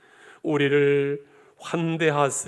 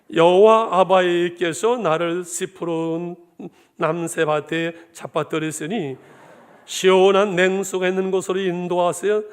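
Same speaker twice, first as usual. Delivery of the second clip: Hamgyeong dialect; 3 semitones higher, 6 dB louder than usual. Retold or imitated. Pastor jokingly reads Psalm 23 to the church in a loud Hamgyeong dialect. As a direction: intone it in a bold North Korean Hamgyeong dialect. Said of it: "함경도 버전 하나 더 할까요".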